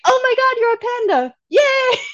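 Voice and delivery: high, loud squeal of delight; mock-thrilled squeal; gushing delight. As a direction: gush like a fan who just met a panda. Everyone gushing over the panda.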